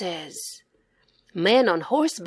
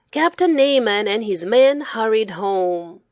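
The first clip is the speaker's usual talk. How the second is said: ordinary delivery, nothing marked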